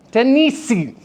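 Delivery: TENNESSEE with TEN and NES punched high, last syllable dropped; stress on first two syllables. Shown as a demonstration. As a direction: unnatural TEN-NES-see, high attack falling away. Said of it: "syllable stress to the first and second".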